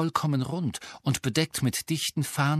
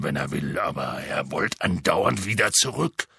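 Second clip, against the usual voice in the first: deep rough half-giant voice; low booming gamekeeper; Hagrid voice; 8 semitones lower than usual. Acted. Hagrid explains.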